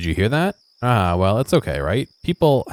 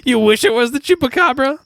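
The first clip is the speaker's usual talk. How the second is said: high, far squeaky voice, laughing; squeaky high guess; 3 dB louder than usual. Pretend. Guessing the monster for the groom, squeaky high. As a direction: pipe up high and silly. Playing silly guessing with game.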